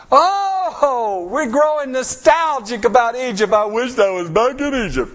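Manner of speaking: mock whine. Mocking Israelites longing for Egypt.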